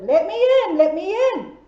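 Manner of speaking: hungry wolf demanding to get in; wolf shouting. Acted. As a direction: bang the door and shout it. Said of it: "knocked on the door and shouted".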